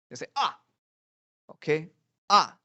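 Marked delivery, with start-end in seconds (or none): 0.3-0.6 short falling yelp as if hit; quick mock cry of pain, dropping sharply. Teacher acts being hit on head, short falling cry. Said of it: "somebody hit you on the head". 2.3-2.6 short falling mock yelp; abrupt "Ah!" dropping fast; pained "Ah!". Teacher repeats being hit on head, sharp falling cry. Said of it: "somebody hit you on the head".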